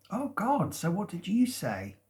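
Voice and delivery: high-pitched retold astonished reaction; mock surprise. Friend asking what was said after the news, surprised. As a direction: act out a friend's eager surprise.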